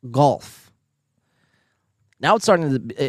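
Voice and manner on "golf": louder "Golf" with the L in; claimed pronunciation. Shown as a demonstration. Proving it to chat, firm "Golf" with L. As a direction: say "Golf" firmly, alone, sounding the L. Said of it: "I say golf".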